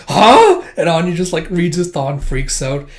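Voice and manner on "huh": very high, loud startled yelp; shocked huh. Retold or imitated. Boy realising she hugged him, very high loud huh. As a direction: jolt up in shock. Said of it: "He's like".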